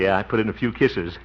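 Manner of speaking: usual speaking voice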